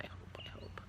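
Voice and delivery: whispered hope. Real, not acted. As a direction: breathe it under your breath. Whispered worry to the dog.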